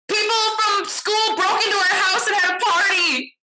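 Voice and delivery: high-pitched panicked squeal, retold; frantic mock confession; panicked blurt. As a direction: blurt it out loud and shrill, hamming up the panic. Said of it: "I just blurted out".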